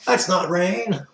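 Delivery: high, slow, loud comic line; Jim Carrey. Retold or imitated. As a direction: deliver it like a goofy film hero. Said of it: "it was Jim Carrey".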